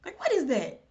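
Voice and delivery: totally lost by the phrase; baffled surprise. Retold or imitated. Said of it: "And I said".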